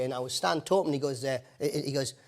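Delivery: ordinary talk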